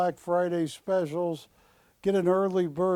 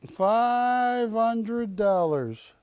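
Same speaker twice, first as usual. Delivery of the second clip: drawn out; 7 semitones higher, 3 dB louder, much slower than usual. Acted. Auctioneer stretching the price.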